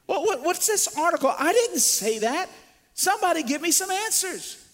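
high, pressing questions tumbling out; a stunned mourner demanding answers; frantic disbelief. Retold or imitated. Grieving man at bad news, high and frantic. Preacher voicing shocked mourner.

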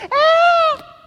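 very high, loud mock infant cry; imitated baby's wail. Newborn as mother touches him, high imitated wail. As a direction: wail like a baby throwing a fit. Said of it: "This brother goes".

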